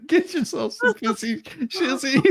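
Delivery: usual speaking voice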